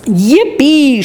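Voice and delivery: high, lightly shouted yippee; a mild hammed-up cheer of joy; old grandfather whooping over the golden ticket trip, high and glad; joyful shout. A narrator's reading. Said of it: "shouted Grandpa Joe".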